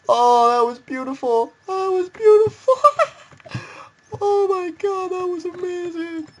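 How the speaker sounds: high, drawn-out squeal dissolving into laughter; overjoyed, cracking up. Real, not acted. Gamer marvelling at own beautiful trick, helpless laughing squeal. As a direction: squeal the praise helplessly, barely over the laughing.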